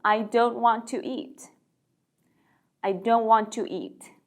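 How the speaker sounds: slow with "don't" stressed; slow model sentence. Shown as a demonstration. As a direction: read the negative slowly for learners. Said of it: "먹기 싫어요". (천천히 부정문 시범)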